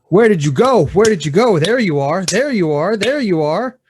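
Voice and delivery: acted tantrum, hitting out with each phrase; mad preschooler. Preschooler flailing for invisible playmate, mad shouting. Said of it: "You succeed at getting mad".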